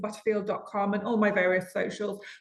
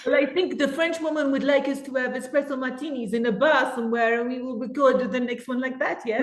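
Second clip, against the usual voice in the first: higher-pitched, hammed-up French woman voice; terrible French accent; 4 semitones higher than usual. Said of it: "That was a terrible accent".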